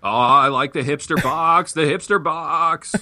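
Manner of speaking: put-on high, drawn-out old movie-star voice; Jimmy Stewart. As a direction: play the guest as a classic film actor, stretching the repeat. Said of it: "Bill Clay sounded an awful lot like Jimmy Stewart".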